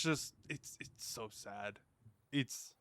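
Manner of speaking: sad. Real, not acted. Sad about his player losing.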